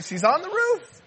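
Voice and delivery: high-pitched comic protest; mock incredulous punchline. Preacher replaying the husband's punchline, mock incredulous.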